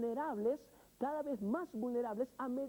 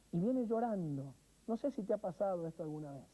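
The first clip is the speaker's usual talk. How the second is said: usual speaking voice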